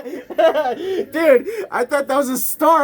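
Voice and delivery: high and loud, laughing through the words. Real, not acted. Admits to his friend he mistook the light, laughing. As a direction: talk while still cracking up.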